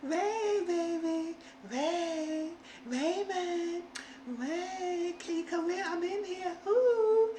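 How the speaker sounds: high falsetto sultry calling; hammed-up come-hither voice calling Ray; sing-song flirty beckoning in a squeaky voice; seductive calling mock. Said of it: "And Carrie said".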